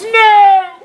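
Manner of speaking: high, loud cry of No; wife's scream. Acted. Wife screams at Caesar as he leaves, loud cry. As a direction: scream it out in alarm. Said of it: "his wife screams".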